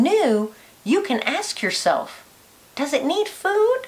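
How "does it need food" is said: ordinary talk, nothing marked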